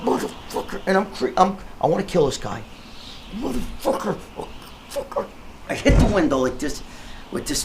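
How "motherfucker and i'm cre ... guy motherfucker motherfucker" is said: straining curse. Retold or imitated. Straining against unseen grip.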